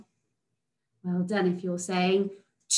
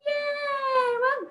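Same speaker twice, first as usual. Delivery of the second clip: cheer. Real, not acted. Celebrating pupil answer.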